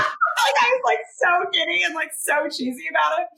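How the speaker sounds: high, loud talk through laughter; real helpless giggly retelling; giddy laughing. Real, not acted. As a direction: tell it while cracking up. Laughing as she retells her giddiness.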